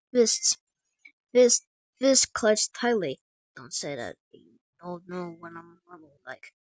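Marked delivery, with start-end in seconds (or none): 3.6-6.5 very low, soft warning; hushed menace. Acted. Angry giant answers a plea to calm down, low and hushed. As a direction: drop it right down to a dark near-murmur.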